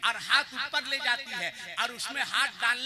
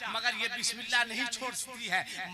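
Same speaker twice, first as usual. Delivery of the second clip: ordinary talk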